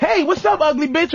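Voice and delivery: a lightly voiced brash guy; mock pickup line. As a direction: swagger it out like a bold player. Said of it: "they come up to a fat bitch and go".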